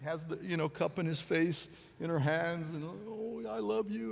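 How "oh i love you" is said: soft, much quieter loving coo; tender comfort. Retold or imitated. Wife holding husband's face, soft tender coo. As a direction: soothe him like a nurse.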